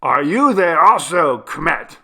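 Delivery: high, loud questioning call; shouting. A narrator's reading. Jivan hearing Perko's voice, high shouted challenge. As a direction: call across to the other side. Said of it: "shouted Jivan".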